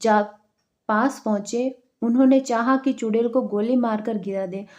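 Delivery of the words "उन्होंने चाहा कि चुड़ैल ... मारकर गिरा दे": ordinary delivery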